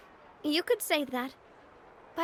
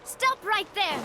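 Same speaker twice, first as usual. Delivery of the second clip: commanding shout; 6 semitones higher, slower than usual. Acted. Heroine ordering fleeing spies to stop, shouting. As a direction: stop them in their tracks.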